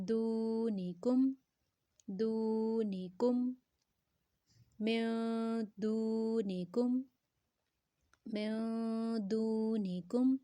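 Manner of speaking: slow "دونی کم" with a long oo in "دو"; elongated recitation. Shown as a demonstration. Teacher models the joined word for learners, elongated recitation. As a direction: stretch "دو", then move on to "نی کم". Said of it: "دو کو لمبا کیے".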